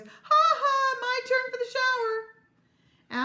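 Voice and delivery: high, loud gloating shout; triumphant childish yell; top-of-voice shout. A narrator's reading. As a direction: crow it slowly at full volume. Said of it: "shouting at the top of his voice".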